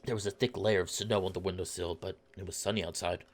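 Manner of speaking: low, soft storybook reading; story narration. A narrator's reading. Narrator describing a snowy tavern morning, low soft narration. Reads visual novel narration.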